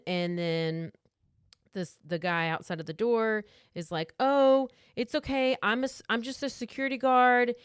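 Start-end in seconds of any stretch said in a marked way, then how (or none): 4.2-7.5 higher, louder lightly acted reassurance; lightly voiced guard, pitched up; reassuring security guard. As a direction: talk your way past a closed door. Voices guard reassuring through door.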